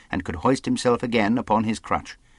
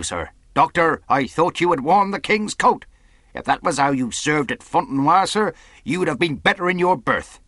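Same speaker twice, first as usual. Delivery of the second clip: stern, higher-pitched voice of command; angry captain; 7 semitones higher, 3 dB louder than usual. Acted. Captain rebukes men who left posts, angry commanding voice. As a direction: dress down the officers. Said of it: "first time we had ever seen him angry".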